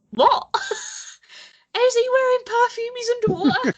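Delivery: high, loud baffled disbelief; incredulous. Retold or imitated. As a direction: react like it makes no sense. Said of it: "and you're like".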